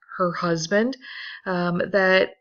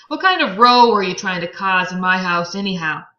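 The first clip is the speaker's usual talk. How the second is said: louder accusing question; confronting Tom; 9 dB louder than usual. Acted. Tom accuses Gatsby in his house, loud and accusing.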